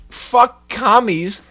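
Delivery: slow, high, loud fuck commies; heated jab. Real, not acted. Streamer ranting about Blizzard, slow and loud. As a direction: drag it out in anger. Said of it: "heated rant".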